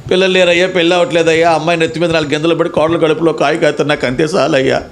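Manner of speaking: imitating a whiny, wailing plea; hammed-up sobbing petition to God; mock tearful prayer. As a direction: put on a wobbly crying chant, running the complaints together. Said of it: "చాలామంది ఏడుపులు".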